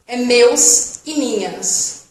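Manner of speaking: meus e minhas said slowly without the S; no final S. Shown as a demonstration. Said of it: "Não pronuncia o S". (meus e minhas sem pronunciar o S, bem devagar)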